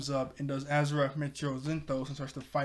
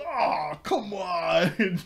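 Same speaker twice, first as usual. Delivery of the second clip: higher, louder mock whine; whiny Cyborg; 3 semitones higher, 5 dB louder, much slower than usual. Cyborg hit by the stink ball, hammed-up whine. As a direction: groan it like a sore loser. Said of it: "He goes".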